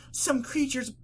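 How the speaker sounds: high, drawn-out tearful wail; starting to cry. A narrator's reading. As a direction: wail it slowly in a high pony voice. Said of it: "she started crying".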